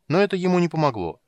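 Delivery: usual speaking voice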